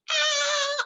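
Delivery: sudden high-pitched yelp of pain; corpse scream. Pretend. Pretend corpse pricked with a needle, shrill high scream. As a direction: shriek as if a needle just stabbed you. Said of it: "Mayatnya pasti jerit".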